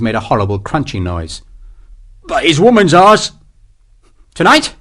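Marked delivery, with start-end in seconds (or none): 2.3-3.3 leering sergeant. Acted. Sergeant scheming. 4.3-4.7 high, eager question. A narrator's reading. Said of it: "couldn't keep the eagerness".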